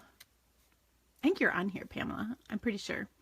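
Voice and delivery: breathy aside, barely voiced; whisper. Real, not acted. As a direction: whisper it as a private aside to the camera. Aside to viewer about Pamela.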